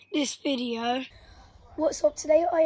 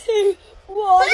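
laughing shout; 10 semitones higher than usual. Real, not acted. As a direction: burst out laughing as the water drops. Countdown then pouring the bucket.

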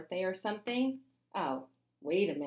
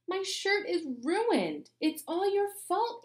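high, loud, slow angry shout; 13 semitones higher, 4 dB louder than usual. A narrator's reading. Emily blaming A J for her ruined shirt, high angry shout. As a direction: blame him at full pitch. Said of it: "she shouted".

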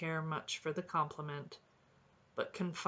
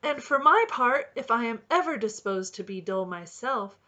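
higher, louder cheerful chatter; gaily; 7 semitones higher, 7 dB louder than usual. A narrator's reading. Robin teasing the woodlark with his own words, gaily. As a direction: keep the glee bubbling. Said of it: "cried the robin gaily".

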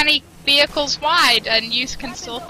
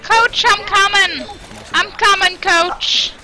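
calling out; 6 semitones higher, slower than usual. Real, not acted. Rushing to rescue teammate.

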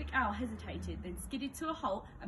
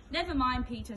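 louder, breezy and cheerful; brightly; 3 semitones higher, 7 dB louder than usual. A narrator's reading. Henry consoling his brother, bright and cheerful. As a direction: cheer him up with mock sympathy. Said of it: "said Henry brightly".

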